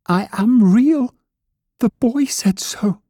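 very high, slow little rabbit voice; near tears. A narrator's reading. Toy rabbit protesting the boy said so, near tears. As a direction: insist slowly, on the verge of crying. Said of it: "he nearly began to cry".

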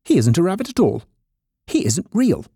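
high-pitched, accusing rabbit voice; exclaiming wild rabbit. A narrator's reading. Wild rabbit exposing the toy, high accusing exclamation. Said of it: "he exclaimed".

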